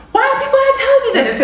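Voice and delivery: high, loud, fast exasperated outburst. Retold or imitated. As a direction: fire it off high and fast in disbelief. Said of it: "I'm like".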